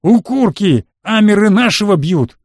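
loud, high rallying yell; shouted alarm. A narrator's reading. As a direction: holler to your mates for help. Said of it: "рядом раздался крик".

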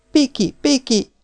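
put-on high, ringing call of a girl; girl calling after the boy who ran out; calling after him. A narrator's reading. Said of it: "kiáltott utána Elza".

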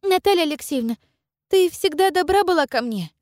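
put-on high pleading girlish voice; young girl voice. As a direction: plead gently as a little girl. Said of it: "начала Дуня".

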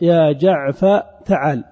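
"جعفر" said as "jaʿfa", straight on to "تعال"; slowly, "jaʿfa" for "جعفر", then "تعال"; r dropped. Shown as a demonstration. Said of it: "يعرف أنك حذفت الراء". (نطق "جعفر" بحذف الراء قبل "تعال")

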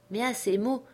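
plain reading voice

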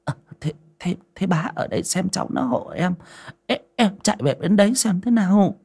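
put-on high, stammering old woman; flustered, faltering plea; hurried and anxious. A narrator's reading. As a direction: hurry to excuse yourself, heart pounding. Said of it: "tim nhảy thình thịch, vội vàng nói".

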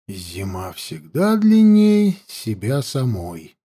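slow, low reciting of verse; expressive poem recital. A narrator's reading. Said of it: "негромко, но с выражением сказал Данилов".

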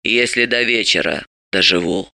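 low, creaky and mournful; gloomy old crone's mutter; sad old woman. A narrator's reading. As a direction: add it sadly, sunk low. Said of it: "и грустно добавила".

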